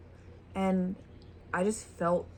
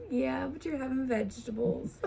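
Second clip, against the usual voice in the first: laughing; 6 semitones higher, 4 dB quieter than usual. Retold or imitated. Teasing aside with laugh.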